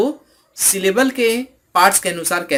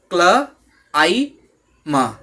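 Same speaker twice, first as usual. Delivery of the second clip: "क्ल, आई, म" as three separate sounds, paused between; sounding out "क्ल, आई, म" piece by piece; 4 dB louder, much slower than usual. Shown as a demonstration. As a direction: break it into "क्ल", "आई", "म", one at a time. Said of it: "जब इसे हम लोग sound से divide करते हैं".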